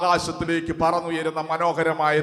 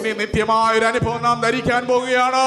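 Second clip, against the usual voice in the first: rising, impassioned preaching cry, higher and higher; fervent preaching; 5 semitones higher than usual. Acted. Preacher calls the church to get ready, in fervent preaching. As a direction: preach it out with growing fire to the congregation. Preacher building to an altar call.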